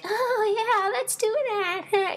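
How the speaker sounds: very high, drawn-out squeaky mock voice; Dovewing. Fan mocking a cat heroine agreeing to meet, high squeaky voice. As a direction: put on a silly falsetto cat voice. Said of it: "that's my Dovewing voice".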